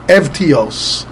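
Evtios as ev-tee-ohs, ending in s; original Greek pronunciation. Shown as a demonstration. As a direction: say "Evtios" with sounded v and final s. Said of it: "pronouncing this word originally".

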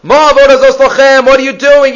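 defiant rebel son, demanding and loud; brazen chutzpah. Shown as a demonstration. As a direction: throw it in their faces with nerve. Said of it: "Ela B'Chutzpah".

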